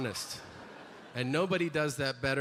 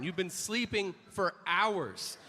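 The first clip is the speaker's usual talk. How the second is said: ordinary delivery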